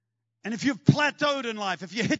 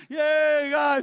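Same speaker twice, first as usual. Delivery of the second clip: higher, louder cheer; elated whoop; 7 semitones higher, 7 dB louder than usual. Acted. Peter whoops to the guys while skiing behind his net, loud and elated. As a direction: whoop it like you're riding a wave.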